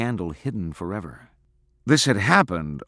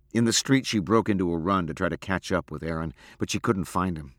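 ordinary delivery, nothing marked

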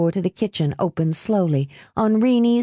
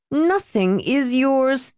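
drawn-out, higher-pitched denial; cold refusal; 7 semitones higher, slower than usual. A narrator's reading. Helen refusing Marie the money, cold and drawn out. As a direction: deny it slowly, pitched up. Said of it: "Helen said, blanching".

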